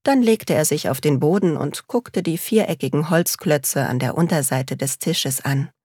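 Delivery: ordinary delivery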